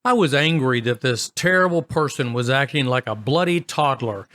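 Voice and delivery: ordinary delivery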